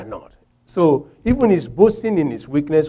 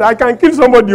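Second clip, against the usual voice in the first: I can kill somebody said louder but calmer, bragging; milder boast; 8 semitones higher, 6 dB louder, faster than usual. Retold or imitated. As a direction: boast it rather than scream. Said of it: "I was forming and saying that".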